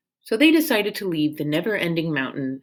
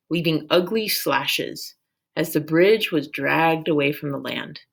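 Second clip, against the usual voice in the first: ordinary delivery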